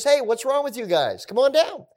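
high, loud mocking challenge; Goliath taunting Israel, a hammed-up jeer; taunting giant. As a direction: goad the army from across the valley. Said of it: "He says".

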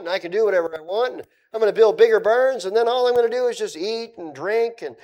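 lightly voiced boastful rich man, loud. Preacher acting out the parable for his congregation, lightly voiced rich man. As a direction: play the rich man crowing over his plans. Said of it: "Remember the rich man?".

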